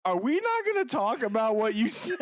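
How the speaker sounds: high-pitched imitation of a disbelieving nephew; incredulous nephew. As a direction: retell it as a high, incredulous question. Nephew's retold incredulous outburst.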